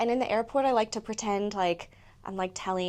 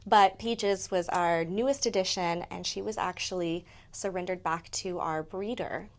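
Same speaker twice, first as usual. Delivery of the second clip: ordinary talk, nothing marked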